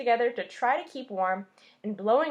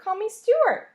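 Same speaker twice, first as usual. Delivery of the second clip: eager; 11 semitones higher, 9 dB louder than usual. A narrator's reading. Stuart insisting cheerfully.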